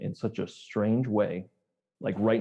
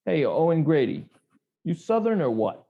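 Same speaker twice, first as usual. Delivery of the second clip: higher, louder mock challenge; teasing question; 6 semitones higher, 4 dB louder than usual. Fan quizzing the hero about his accent, teasing. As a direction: needle him about the accent. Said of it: "here's another question".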